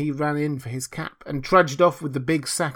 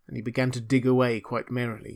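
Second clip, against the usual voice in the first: ordinary delivery, nothing marked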